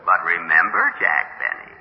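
low voice stretching into a rising finish; tempting inner voice. Acted. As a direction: tempt him slyly, dragging out the end.

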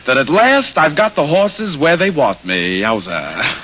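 stuffed-up bandleader's showy patter. Shown as a demonstration. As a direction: greet the crowd like an old maestro with a head cold. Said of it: "catch a cold and drop his French accent, he might sound like this".

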